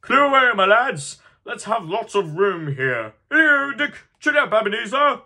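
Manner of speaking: high, loud hearty orders; rally the lads with booming cheer; jolly shouting. Acted. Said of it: "cried old Fezziwig".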